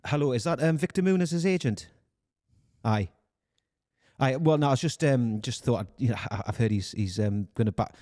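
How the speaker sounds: halting Geordie phone voice; Newcastle accent. Acted. Recruiter ringing another agent, hesitant Newcastle accent. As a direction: try a Geordie accent, losing conviction as you go. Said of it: "Newcastle accent than this".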